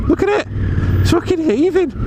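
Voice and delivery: shocked at how busy it is; real exasperated disbelief; incredulous outburst. Real, not acted. Outraged at crowded roads.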